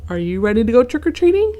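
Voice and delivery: a cheery ghost invitation; lightly voiced ghost. A narrator's reading. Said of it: "the ghost called out".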